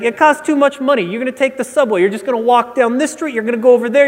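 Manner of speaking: lightly mocking New York clerk brushing him off; the guy's accent. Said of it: "he actually had this accent".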